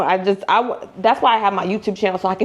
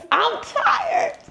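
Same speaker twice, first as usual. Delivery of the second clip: much higher, squeaky tired; comic high-pitched tired. Acted. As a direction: squeak it out in a tiny voice. High squeak after laugh.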